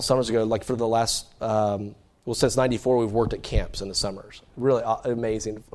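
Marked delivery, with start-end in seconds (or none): none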